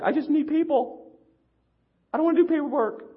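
high, loud fussy whine. Retold or imitated. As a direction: whine it like a spoiled child. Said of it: "I sound really fussy".

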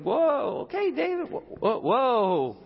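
high mock whoa, backing off; startled men. Men calming David after his rebuke, high mock startle. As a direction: back away from a sudden outburst. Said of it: "And everyone's like".

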